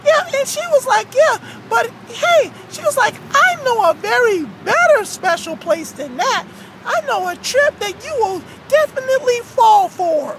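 cartoon storytelling voice; aggrieved Frankie. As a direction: tell the grievance in character. Frankie retells the trip offer.

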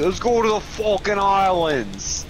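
put-on high, loud, slow Brooklyn accent; hammy Brooklyn tough guy accent. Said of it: "a very stereotypical Brooklyn accent".

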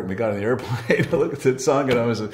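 ordinary talk

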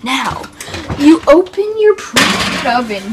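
ordinary talk

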